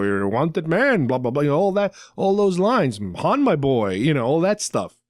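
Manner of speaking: ordinary delivery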